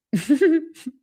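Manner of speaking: laugh. Real, not acted.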